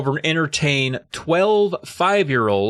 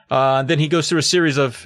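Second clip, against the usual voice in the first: ordinary delivery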